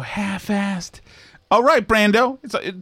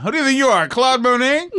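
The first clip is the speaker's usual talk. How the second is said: higher, mock-scolding put-on voice; mimicking the caller; 5 semitones higher than usual. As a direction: echo the caller's indignant character. Host mimics caller's scolding bit.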